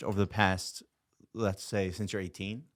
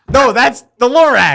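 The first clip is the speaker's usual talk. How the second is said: laughing shout; 12 semitones higher, 14 dB louder than usual. Real, not acted.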